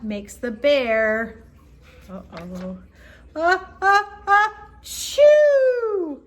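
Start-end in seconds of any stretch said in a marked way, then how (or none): 2.1-2.8 hushed uh-oh. A narrator's reading. Suspense before sneeze. 3.4-6.2 building ah-ah-ah into a loud high choo; big sneeze. Acted. Sleeping bear sneezing from the pepper, building to a loud burst.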